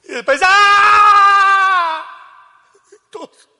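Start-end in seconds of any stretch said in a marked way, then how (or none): 0.4-2.0 loud high wail; high scream. Retold or imitated. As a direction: let out a sharp anguished cry. José's scream before crying.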